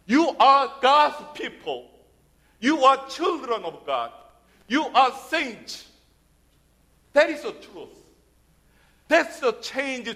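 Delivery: normal talking voice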